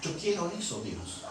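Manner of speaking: low, hushed acted plea; subdued, softly mournful praying; tearful prayer. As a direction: humbly pour it out to God. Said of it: "en eso que lloraba".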